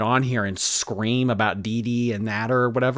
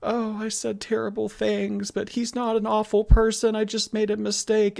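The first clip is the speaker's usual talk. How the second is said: high mock-soft imitation of her apology; hammed-up soft-spoken mimicry; 12 semitones higher than usual. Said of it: "the soft-spoken".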